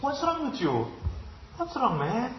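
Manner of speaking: high, slow, baffled what's wrong; puzzled English speaker. Acted. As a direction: ask it as a bewildered foreigner.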